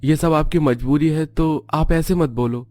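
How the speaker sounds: put-on high-pitched child's voice, pleading gently; earnest young son. Son tells mother not to make promises, earnestly.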